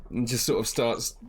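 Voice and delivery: ordinary talk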